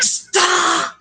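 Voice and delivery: high, drawn-out panicked cry; acted alarm, shooting up in pitch; sudden yell. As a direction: imitate a passenger screaming at the driver. Passenger yells stop in car.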